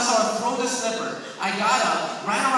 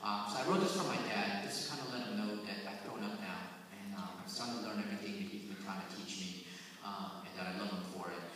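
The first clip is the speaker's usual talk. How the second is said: quiet, earnest, lower voice; sincere; 9 semitones lower, 9 dB quieter than usual. Real, not acted. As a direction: speak it softly from the heart. Sincere closing before poem.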